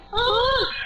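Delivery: high, hammed-up operatic yawn sung out; a mock opera note mid-yawn, half laughing; imitated opera yawn. Twin imitating her yawn that people compared to opera. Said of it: "people said it sounded like ... opera".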